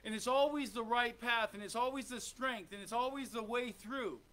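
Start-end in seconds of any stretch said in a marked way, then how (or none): none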